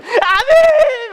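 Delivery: very high, loud, slow amen; drawn out amen. Retold or imitated. Preacher mimicking the crowd, slow, loud, very high amen. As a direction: stretch the amen out fervently.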